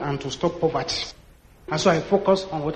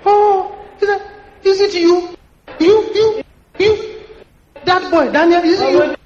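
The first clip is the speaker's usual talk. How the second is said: high, loud astonished cry; loud astonished disbelief; shocked recognition; 11 semitones higher, 6 dB louder than usual. Retold or imitated. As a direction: gasp as you recognise him.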